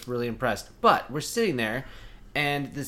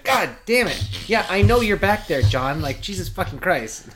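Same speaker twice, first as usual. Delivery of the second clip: loud high mock exasperation at a jab in the ribs; annoyed outburst; 6 semitones higher, 7 dB louder, slower than usual. Girlfriend retelling being poked in the ribs, loud and fed up. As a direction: snap at him, fed up. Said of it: "I'm like".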